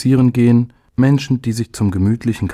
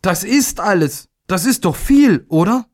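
high, bewildered pleading voice; stammered protest; 10 semitones higher than usual. A narrator's reading. Little day defends his report to father, stammering and bewildered. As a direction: plead upward, unsure of yourself. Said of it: "stammelte er".

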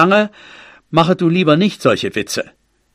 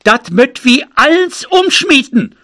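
calling out; 8 semitones higher than usual. A narrator's reading. Frau Kirschbeet calls to the assembled neighbours, high raised cry. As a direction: call it out, pitched well up. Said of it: "Frau Kirschbeet rief".

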